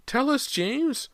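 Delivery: very high, loud, slow terrified shriek; mother's terrified shriek at the unseen boy. A narrator's reading. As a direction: shriek in a terror never heard before. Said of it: "shrieked in a terrified voice".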